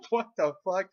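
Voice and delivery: loud, high incredulous outburst; amused disbelief. Retold or imitated. Seeing his friend coming with the stick, incredulous. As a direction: blurt it in laughing shock.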